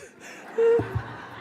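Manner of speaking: high burst of laughter; high amused laugh; delighted high laugh. Real, not acted. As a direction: crack up with delight. Laughing at pistol story.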